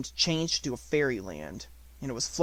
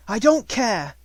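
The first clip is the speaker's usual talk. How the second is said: much higher and louder, a defiant cry; 8 semitones higher, 8 dB louder, slower than usual. A narrator's reading. Sarah insisting on her dream, alone in the attic: loud cry. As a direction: fling the words out, higher and louder. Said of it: "she cried".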